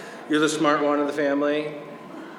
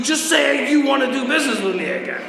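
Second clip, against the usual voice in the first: high, raised-voice mock pleading; imitated panic; 9 semitones higher, 4 dB louder than usual. Boo begs Dan for a reference, high mock panic. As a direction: imitate a desperate caller begging, pitched right up. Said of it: "He calls me up all panicked".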